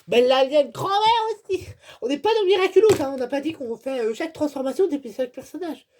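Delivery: high, loud, outraged complaint; exasperated rant. Real, not acted. Fan complaining to viewers about repeated figures, outraged high loud rant.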